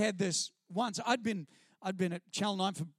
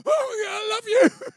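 high, loud, slow teary squeal; gushing at his childhood hero, mock overwhelmed; starstruck gush; 11 dB louder, slower than usual. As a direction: fan meeting his hero, voice cracking with emotion. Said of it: "And I was like".

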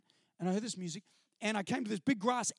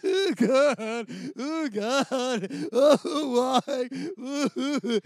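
louder, crying oh God then why; imitated crying, voice breaking; sobbing; 3 semitones higher, 7 dB louder, much slower than usual. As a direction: sob while pushing through the crowd. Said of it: "walking through all these people going".

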